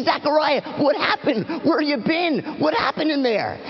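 eager crowd pressing the returning priest; anxious calling out. Acted. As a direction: call out to him, demanding answers.

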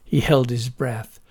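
ordinary delivery

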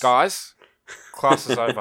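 mock teacher telling the class to leave; loud call to class. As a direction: call out loudly to the whole class. Voicing Chang ending class.

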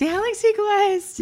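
light up at the name and stretch it out; excited gush. Real, not acted. Podcast host thrilled her co-host named a favorite film, excited squeal.

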